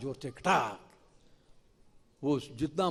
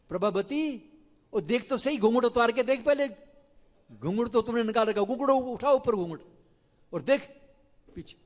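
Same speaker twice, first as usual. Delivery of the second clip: high, louder mocking call; laughing at her, tell her to lift the veil; Yashoda teasing; 7 semitones higher, 6 dB louder than usual. Yashoda mocks Prabhavati to lift her veil, loud and high. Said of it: "तो यशोदा कहती हैं".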